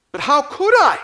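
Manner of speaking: put-on a tearful child's anguished plea; sobbing. A narrator's reading. Child pleading through sobs to her nanny. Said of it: "she sobbed".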